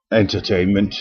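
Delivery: normal talking voice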